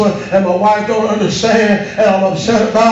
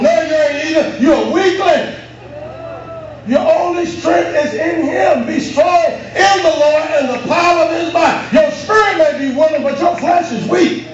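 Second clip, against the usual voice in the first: preaching at full force; 5 semitones higher than usual. Acted. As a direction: drive every phrase home. Preacher's high climax.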